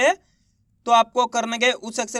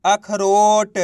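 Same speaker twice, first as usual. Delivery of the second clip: very drawn-out model word; slow blended reading; 4 dB louder, much slower than usual. Shown as a demonstration. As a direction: stretch every syllable while joining them into one word. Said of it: "तो मिलके बन जाएगा". (अक्षर मिलाकर खूब खींचकर पढ़ना)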